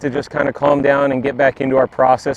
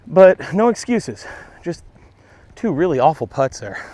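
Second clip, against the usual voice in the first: ordinary delivery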